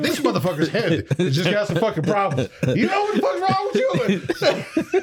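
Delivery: high-pitched comic tirade; hammed-up outrage rising into a drawn-out squeal; mock rant. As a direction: go off at a passer-by, half laughing, climbing very high. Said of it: "just keep it going".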